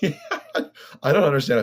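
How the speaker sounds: loud, high, laughing disbelief. Real, not acted. Marvelling at the musician's energy on stage, laughing. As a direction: laugh your way into the words. Amused by musician running about.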